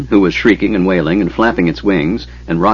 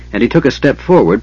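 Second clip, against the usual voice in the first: usual speaking voice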